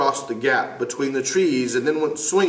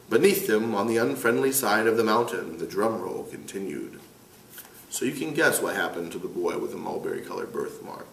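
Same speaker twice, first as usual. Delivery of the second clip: plain reading voice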